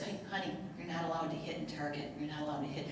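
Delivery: very soft, low, kindly correction; gentle. Retold or imitated. Shopper to a five-year-old hitting his brother, gentle soft reproof. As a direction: speak softly, as to a small child. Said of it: "I just gently said".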